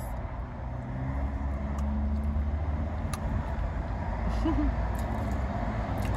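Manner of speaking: long wheezy silent laugh. Real, not acted. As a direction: keep laughing with no voice. Long laugh after joke.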